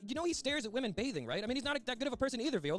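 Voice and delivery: high-pitched, rattled arguing; indignant protest. Acted. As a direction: plead your case in a rising, agitated voice. Acted phone argument with Viola.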